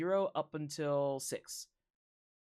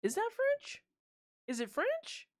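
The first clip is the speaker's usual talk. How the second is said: surprised; slower than usual. Real, not acted. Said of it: "sounds French".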